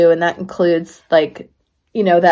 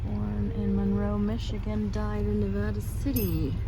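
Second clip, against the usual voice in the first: slow breathy hush; a slow graveyard whisper; 14 dB quieter than usual. Real, not acted. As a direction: breathe it almost silently. Quietly reading graveyard headstones.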